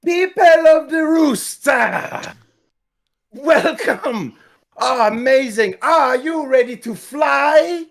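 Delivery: high, loud, slow hype call; rally the crowd; rousing crowd shout. Acted. Character hypes the crowd.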